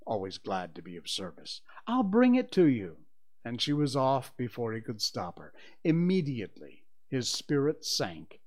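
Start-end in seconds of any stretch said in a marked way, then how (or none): 1.9-3.1 high, loud and eager; eager girl calling out, pitch up high; eager cry. A narrator's reading. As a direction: call out brightly to help. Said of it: "Wendy cried".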